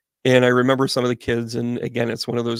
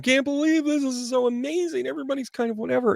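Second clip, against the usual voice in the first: much higher, breathless gushing official voice, last line fast; mock the starstruck dignitaries; 13 semitones higher, faster than usual. Dignitaries marveling at the kids on the field, in a high gushing voice.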